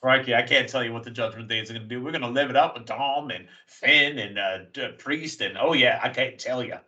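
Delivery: bad Australian accent, fairly brisk; Rhea Ripley. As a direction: put on a clumsy Aussie accent. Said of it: "I'm doing a bad Australian accent".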